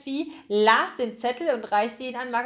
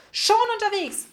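high, bright-pitched call, a bit louder; 10 semitones higher, 4 dB louder than usual. A narrator's reading. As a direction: call back across a busy shop. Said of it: "rief Margarete".

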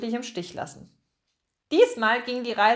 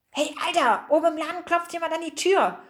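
higher, hurried call to a sleeper; urgent wake-up call; 6 semitones higher, faster than usual. Acted. Pomme de Terre wakes a friend as someone knocks upstairs, urgent. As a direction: wake him with a quick, raised voice. Waking the dreaming Pikandu.

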